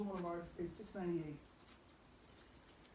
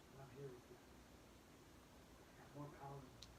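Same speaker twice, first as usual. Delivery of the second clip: much softer, low and intimate; Jesus voiced in a hushed comforting tone; tender reassurance; 4 semitones lower, 9 dB quieter than usual. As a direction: comfort them like a father at bedside. Voicing Jesus reassuring disciples.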